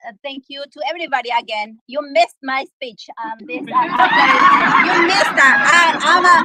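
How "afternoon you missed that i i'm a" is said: laughing shout. Real, not acted. Jokingly scolds late arrivals.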